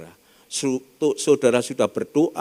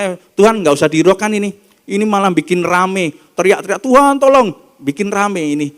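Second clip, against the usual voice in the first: loud, impatient brushing off; dismissive disciples; 3 semitones higher, 7 dB louder than usual. Acted. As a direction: wave her away as a nuisance.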